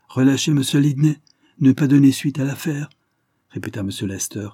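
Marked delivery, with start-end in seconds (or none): 0.1-2.9 higher, louder, indignant echo; affronted, raised-voice repetition; incredulous repeating. Acted. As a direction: throw the request back in disbelief. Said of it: "en regardant fixement sa fille".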